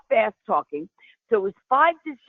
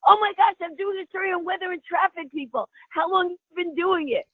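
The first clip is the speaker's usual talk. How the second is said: excited reporter; 9 semitones higher than usual. Retold or imitated. Reporter voiced in story.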